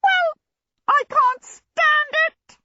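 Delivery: very high, slow, loud shriek; small djinn shrilling in exasperation. A narrator's reading. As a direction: squeal it out, stretching the words. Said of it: "he shrilled".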